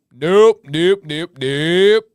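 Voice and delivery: loud repeated nope; emphatic refusal. Real, not acted. Refusing blue cheese to chat, loud nopes. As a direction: shut it down hard, loudly.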